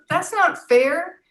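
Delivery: loud, slow, drawn-out protest; mock indignant protest. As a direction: act wounded, stretching out that's not fair. Teacher answers a student's insult.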